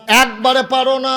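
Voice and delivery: high, loud preacher's cry; loud challenge. Real, not acted. Preacher repeats challenge, escalating.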